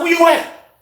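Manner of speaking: high call asking where he is; imitating a high call. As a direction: call up toward the cell. Calling up to Jeff.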